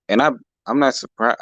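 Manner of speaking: usual speaking voice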